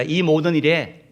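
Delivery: loud, slow recitation; leading a repeat-after-me. Shown as a demonstration. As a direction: call out the verse slowly for everyone to echo. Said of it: "따라합시다". (따라 하도록 크고 느리게 선창)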